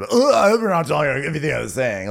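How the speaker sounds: high, fast, exaggerated mimicry of a condescending scold; hammed-up condescending quote; mocking condescension. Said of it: "so condescendingly".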